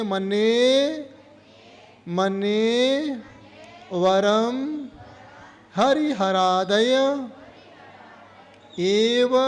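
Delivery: slow, drawn-out verse recitation; recitation for repetition. Shown as a demonstration. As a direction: recite word by word for pupils to repeat. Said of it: "बोलिए".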